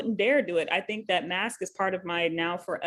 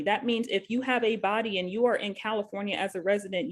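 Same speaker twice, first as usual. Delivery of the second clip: ordinary talk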